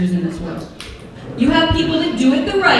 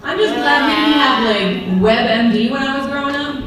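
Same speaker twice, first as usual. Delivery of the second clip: ordinary talk, nothing marked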